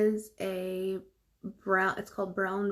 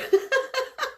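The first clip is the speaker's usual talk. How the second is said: squealing laugh; a sudden high delighted laugh; loud high giggle; high burst of laughter; 8 dB louder than usual. Real, not acted. Laughs at viewer comment.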